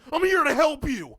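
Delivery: high, loud reenacted yell; exasperated yelling. Man retells coaxing cat into carrier, yelling. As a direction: plead at full volume with a stubborn cat. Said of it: "Me yelling at the cat".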